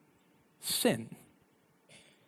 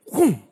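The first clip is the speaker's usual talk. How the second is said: a sudden loud boom, pitched up; mock boom; 6 semitones higher, 8 dB louder than usual. Retelling grabbing the Tabasco, loud boom. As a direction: punch it out as a sound effect. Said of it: "sound effect of grabbing".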